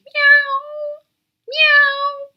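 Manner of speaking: very high, loud meow; high cat calls. Acted. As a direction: mew like a talking cat. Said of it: "They say".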